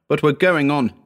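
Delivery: high, firm and resolute; determined. A narrator's reading. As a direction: stand firm against fear. Howard answers firmly.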